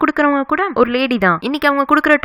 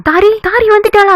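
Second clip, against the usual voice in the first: high, louder hopeful call; eager hope; 8 semitones higher, 5 dB louder than usual. A narrator's reading. Hero hears a knock and hopes it is Tari, calling eagerly. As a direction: call out eagerly for someone awaited. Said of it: "அப்படின்னு ஆசையா ஓடி வந்து".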